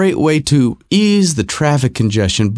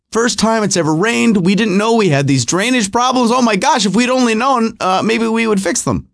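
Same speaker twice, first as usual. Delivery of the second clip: higher mock-innocent locals; mock naive Californians; 8 semitones higher than usual. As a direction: play the clueless locals acting shocked by rain. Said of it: "act like it's the first time".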